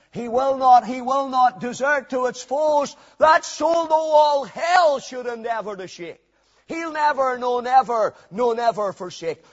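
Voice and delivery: forceful preacher reciting verse, loudest at the start; impassioned hymn recitation. Acted. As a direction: drive each repeated vow home from the pulpit.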